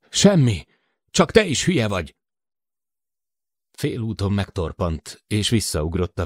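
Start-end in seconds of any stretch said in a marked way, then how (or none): 0.1-2.1 irritated. Acted. Ope snapping back angrily.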